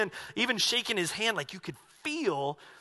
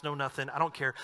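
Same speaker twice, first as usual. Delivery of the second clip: ordinary talk, nothing marked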